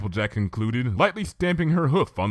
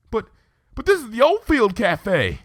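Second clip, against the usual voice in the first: alarmed; 7 dB louder than usual. A narrator's reading. Applejack shocked at friend's order.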